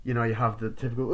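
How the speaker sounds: normal talking voice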